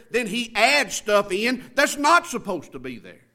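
punchy pulpit exhortation; forceful preaching. Real, not acted. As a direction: drive each point home to the congregation.

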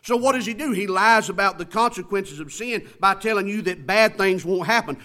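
punchy pulpit exhortation; forceful preaching. Real, not acted. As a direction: drive each point home to the congregation.

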